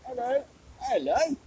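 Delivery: hammed-up Cockney hello; mock Cockney. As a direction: play a cartoon Cockney greeting. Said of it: "pronounce everything with rising intonations".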